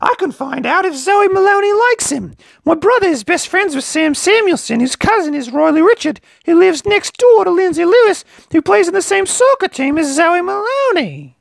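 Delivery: very high, rapid gossipy rush; eager chatter. A narrator's reading. Littlest teen eagerly explaining who knows who, high and excited. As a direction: rattle off the chain in one breath.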